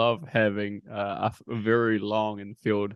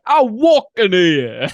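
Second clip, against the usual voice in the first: higher, loud, slow hammed-up Boston accent; mock Boston accent; 10 semitones higher, 8 dB louder, slower than usual. A host joking about how badly they do Boston accents. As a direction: strut in with a cartoon Boston swagger. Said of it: "so bad at Boston accents".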